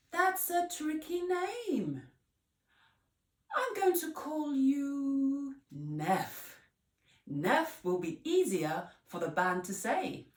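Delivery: high, breezy, bossy teacher voice; decide it for her brightly; bossy Miss Potts. Acted.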